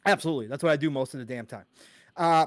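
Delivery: ordinary delivery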